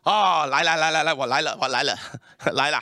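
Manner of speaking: put-on eager old plumber in Mandarin, fast, laughing; 岳父急忙答应; father-in-law plumber. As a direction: play the father-in-law hurrying over. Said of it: "my father-in-law come".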